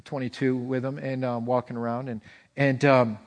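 ordinary talk, nothing marked

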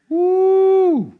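a sharp, high excited "woo"; excited whoop. Real, not acted. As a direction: whoop at the drama of it. Preacher reacts to Goliath's taunt.

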